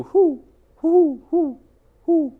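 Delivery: high, loud hooting whos; owl hooting. Acted. The great horned owl hooting at the skunk, high and loud.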